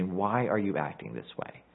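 put-on soft, low parent gently asking a sulking child; parental voice. God as parent questioning the pouting Jonah, gently parental. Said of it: "a very parental type of voice there".

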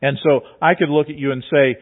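ordinary talk, nothing marked

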